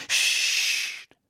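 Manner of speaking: loud, sharp hissed "shh"; hissed shush. A narrator's reading. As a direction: hush them with a forceful hiss. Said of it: "zischte sie".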